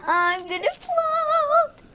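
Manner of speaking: very high, loud, stretched-out silly voice; sing-song squeal as if floating away; loud cartoonish high voice; voiced floating fire. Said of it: "it's just like".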